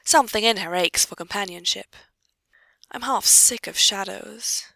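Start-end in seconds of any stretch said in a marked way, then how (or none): 2.9-4.6 slow, drawn-out wistful line; wistful murmur. A narrator's reading. Weary lady alone at her weaving, wistful murmur. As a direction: murmur it with slow, gentle longing. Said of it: "she murmurs wistfully".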